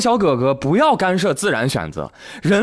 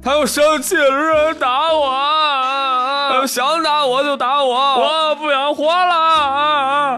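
aggrieved whining; 9 semitones higher than usual. Retold or imitated. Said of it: "还特委屈".

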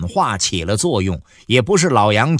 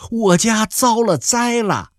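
high, slow tearful voice; crying; 10 semitones higher than usual. Acted. Young wife crying to her husband about disaster at home. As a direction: say it through tears, much higher. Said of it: "白麦哭着说".

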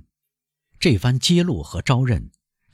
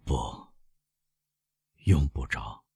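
soft, strangled refusal; words half stuck in the throat; choked stammer; 7 semitones lower, 6 dB quieter, slower than usual. A narrator's reading. Prosecutor refuses proof in a soft choked voice. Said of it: "用憋住的声音痴痴地说".

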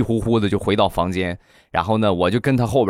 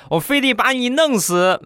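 high, shrill-pitched mock yell of a furious little boy; crying-yelling kid. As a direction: yell it like a kid who just got beaten. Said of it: "小的哭着喊着". (小孩哭喊着放狠话)

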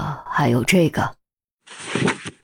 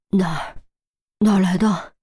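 gasping out the words between breaths; breathless, short of air; 4 dB quieter than usual. A narrator's reading. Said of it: "扶着桌子大口喘气".

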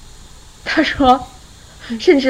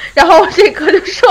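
ordinary delivery

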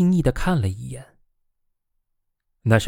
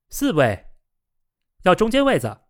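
mild shout, pitched up high; lightly raised, eager call; 7 semitones higher than usual. A narrator's reading. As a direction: call out to a waiter. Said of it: "菲比大喊道".

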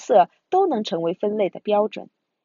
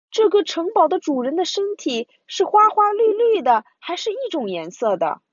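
put-on bright child voice; 8 semitones higher than usual. Bear brother asks question.

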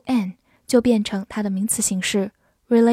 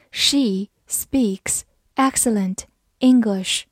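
slow, careful sentence read; slower than usual. Shown as a demonstration. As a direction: read it slowly for learners. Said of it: "slow then normal reading".

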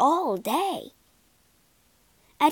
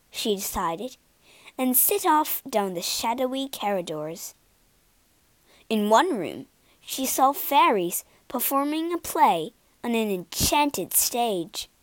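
ordinary delivery

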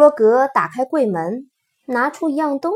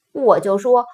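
usual speaking voice

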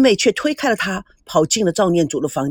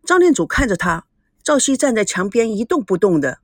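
ordinary delivery

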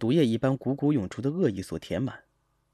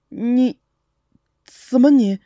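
high, halting words of shock; broken-off startled question; stunned, speechless; 6 semitones higher, 6 dB louder, much slower than usual. Acted. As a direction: stammer it out in astonishment. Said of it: "惊讶地说不出完整的话来".

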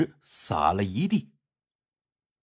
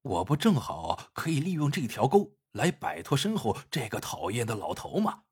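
softer, thinking to himself; a quiet private scheming thought; inner thought; 4 semitones lower, 6 dB quieter than usual. A narrator's reading. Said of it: "他转念一想".

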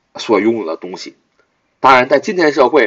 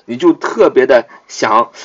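ordinary delivery

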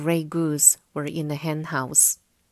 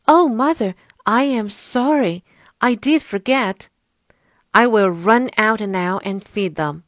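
put-on higher, louder sorry little boy; young boy voice; 4 semitones higher, 7 dB louder than usual. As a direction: play an apologetic young boy. Jack apologizes to Mother.

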